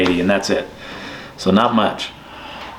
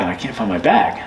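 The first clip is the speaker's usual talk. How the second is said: ordinary talk, nothing marked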